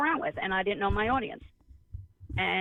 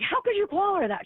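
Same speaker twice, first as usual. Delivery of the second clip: appalled; 12 semitones higher, 3 dB louder than usual. Retold or imitated. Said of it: "I just was so appalled".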